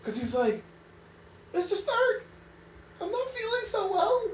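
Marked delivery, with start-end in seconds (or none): 1.5-4.2 put-on much higher, whiny young hero; high whiny dying teen hero; whiny Spider-Man voice. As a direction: whimper it like a scared kid. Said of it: "That's my whiny Spider-Man voice".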